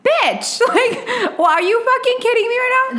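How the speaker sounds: high-pitched mock fury; outraged disbelief. Sister fuming at useless brother, high incredulous outburst. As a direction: act out an incredulous blow-up. Acting her yelling at brother.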